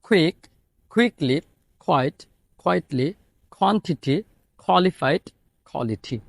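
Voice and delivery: each K said like খ, as in কুইক and কোয়ালিটি; aspirated K words. Shown as a demonstration. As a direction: puff the air out on every K, word by word. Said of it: "বাংলা খয়ের মতো উচ্চারণ করতে হবে".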